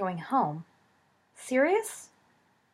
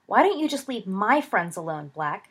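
plain reading voice

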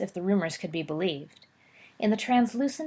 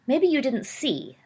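through clenched teeth; 6 semitones higher, 3 dB louder, slower than usual. Acted. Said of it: "said Potter between clenched teeth".

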